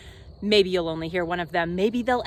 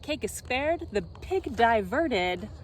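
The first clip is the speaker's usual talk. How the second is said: ordinary delivery, nothing marked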